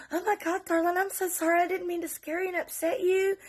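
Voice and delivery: high, fussing concern; apologetic dad. Retold or imitated. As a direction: imitate a remorseful man soothing a child. Man apologises to frightened child, retold.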